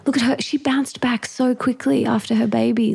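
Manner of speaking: a jealous little aside about another mum; envious comment. Retold or imitated. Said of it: "Those little comments".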